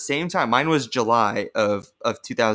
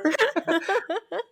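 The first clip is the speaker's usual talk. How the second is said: high squealing laugh; 4 dB quieter than usual. Real, not acted.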